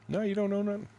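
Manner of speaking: high-pitched imitation of a clerk; baffled official. Supervisor after checking it again, high mock bafflement.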